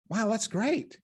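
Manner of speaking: high-pitched cheery amazement, acted; mock delight. Therapist hearing of client's horrible week, acted delight. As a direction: act pleased at bad news.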